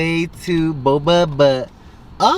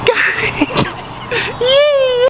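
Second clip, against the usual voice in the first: excited squeal. Real, not acted. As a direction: squeal it with glee. Excited about the big drinks.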